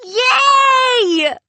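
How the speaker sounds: high, loud joyful yell; cheering. A narrator's reading. Kids cheer at the captain's deal, loud joyful yell. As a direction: burst out in a cheer. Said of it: "burst out cheering".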